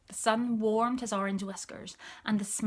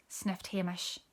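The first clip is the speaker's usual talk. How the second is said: usual speaking voice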